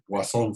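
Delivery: "Poisson" pronounced "pwa-SON", the French way; French-style "Poisson", no "oy" sound; French pronunciation. Shown as a demonstration. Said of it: "se pronuncia".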